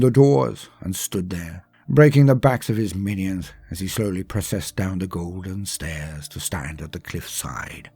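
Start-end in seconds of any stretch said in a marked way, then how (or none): none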